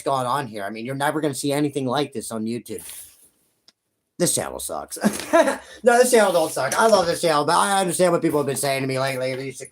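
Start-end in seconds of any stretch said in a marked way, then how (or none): 4.2-5.0 much lower, deadpan jab at his own channel; mock self-put-down. Mock self-insult, then laughs. 5.0-5.6 a quick high burst of laughter at his own joke; short laugh. Real, not acted.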